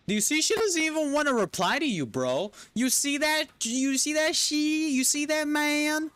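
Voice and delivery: squealed, hammed-up indignation; high-pitched outrage. Complaining she won't reply, high-pitched outrage. Mock outrage at being ignored.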